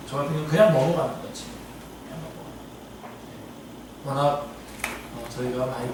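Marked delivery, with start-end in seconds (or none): 2.0-4.0 very quiet, slow, almost voiceless repeat; under his breath. Real, not acted. Preacher admitting to congregation he lets things pass, under his breath. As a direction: let it fade into a near-whisper.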